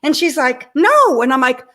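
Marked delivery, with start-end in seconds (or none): 0.8-1.2 higher, louder mock refusal; imitated refusal, pitched up and punched; retold flat refusal. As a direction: act out a clerk's emphatic no. Clerk refuses her request.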